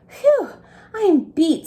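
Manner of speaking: high, loud worn-out truck voice; tired Crane. Acted. Crane truck sighs at the whistle, high and worn out. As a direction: puff it out like a beat crane. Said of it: "said Crane".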